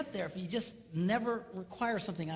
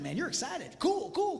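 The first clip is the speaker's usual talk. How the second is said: ordinary talk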